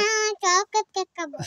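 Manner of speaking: loud, pleading demand for cake; ছেলে জোরে জেদ করে কেক চাইছে. Real, not acted. Child insisting to mother.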